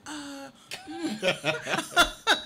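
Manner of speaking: high, loud, tongue-tied giggle; flustered laugh. Retold or imitated. Girl stunned by the band member's phone offer, giggling. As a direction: stammer it like a caught-off-guard girl. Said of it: "I was like".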